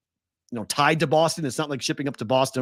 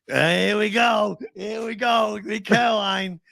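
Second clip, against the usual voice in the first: put-on high, slurred drunken chant with a Boston accent; drunk guys' anthem; 7 semitones higher, slower than usual. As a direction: imitate rowdy drunks chanting their anthem. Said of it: "it's the drunk guy's anthem".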